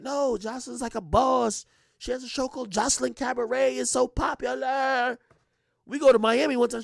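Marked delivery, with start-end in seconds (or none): 0.0-5.2 put-on lightly raised, eager voice of his girlfriend; girlfriend's voice. A hint of the girlfriend defending Jocelyn, eager. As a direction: play her as proud of her show. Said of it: "She was like".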